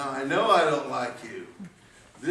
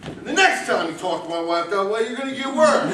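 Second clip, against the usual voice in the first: rising, louder, furious scolding; angry outburst; 9 semitones higher, 5 dB louder than usual. Acted. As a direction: tear into him for upsetting his wife. Husband berating man over wife.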